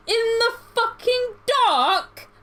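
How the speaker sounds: a hammed-up shocked boyfriend; incredulous outburst. Boyfriend's disbelief, retold.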